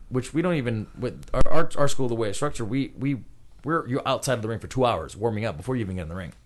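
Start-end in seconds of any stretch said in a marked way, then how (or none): none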